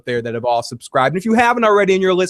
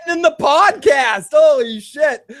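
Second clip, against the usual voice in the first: loud, high excited outburst; excited shout; 9 semitones higher, 4 dB louder than usual. Real, not acted. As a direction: shout it in delighted shock. Caller threatens the podcast.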